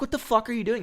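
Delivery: hammed-up outraged shriek at a bad driver; mock furious screech. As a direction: replay the screaming fit in a shrill put-on squeal. Retelling yelling at reckless driver.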